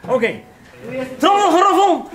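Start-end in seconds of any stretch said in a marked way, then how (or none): 1.2-2.1 drumroll announcement. Acted. Announcing third place with drumroll.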